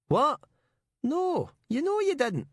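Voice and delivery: very high Glaswegian protest; broad Glaswegian accent. A narrator's reading. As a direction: deny it in squawking Glaswegian. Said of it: "Colin Miller's broad Glaswegian accent".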